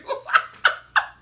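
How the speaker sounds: loud burst of laughter. Real, not acted. Storyteller cracking up over the bystander's warning, loud laugh. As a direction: crack up at your own story.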